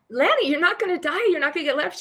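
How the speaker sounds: pitched high, insisting she won't die; shocked, almost squeaking, come on; incredulous reassurance. Retold or imitated. Reassuring her scared friend, retold.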